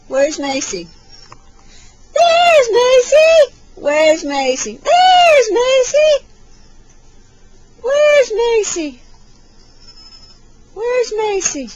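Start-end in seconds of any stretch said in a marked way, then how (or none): 2.1-3.6 high, loud, stretched-out squeal; slow, high, excited singsong; peekaboo reveal. Pretend. 4.8-6.2 very high, loud and drawn out; slow, high, excited singsong; peekaboo reveal. Pretend. 7.8-11.7 high singsong question; high-pitched playful baby talk; peekaboo searching. Pretend.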